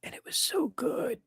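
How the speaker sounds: whispered, drawn in with delight; gushing whisper. Real, not acted. Fan gushing over a favorite movie, whispered.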